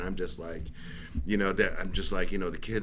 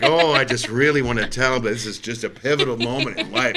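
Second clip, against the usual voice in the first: mock serious dad; 3 semitones higher, 6 dB louder than usual. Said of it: "so serious".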